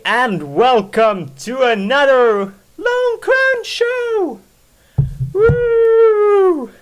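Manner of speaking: big high energetic YouTube intro; loud, high, hyped welcome; excited greeting. Real, not acted.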